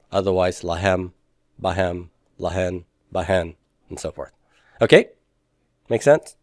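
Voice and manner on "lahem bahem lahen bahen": "lahem, bahem, lahen, bahen" each stressed on the last syllable; soft, low list, la-HEM, ba-HEM, la-HEN, ba-HEN; weight on "hem" and "hen" in "lahem, bahem, lahen, bahen"; stress on the end. Shown as a demonstration. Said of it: "stress on the end".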